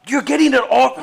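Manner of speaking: high, loud yelp of protest; disgusted shout. Real, not acted. Host recoiling from co-host's spit, shouting high. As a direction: cry out in real disgust, voice shooting up.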